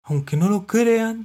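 high, loud, slow voice; mollusc answering. A narrator's reading. Mollusc answering the cat, high and slow. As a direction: draw it out in a high register. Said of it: "contestó Mitilo".

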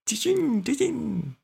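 high singsong catchphrase, falling at the end; playful, between laughs. A narrator's reading. Santi burying himself in sand, playful and high. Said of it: "dijo Santi entre risas".

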